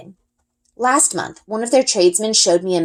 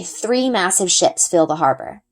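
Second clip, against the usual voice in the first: usual speaking voice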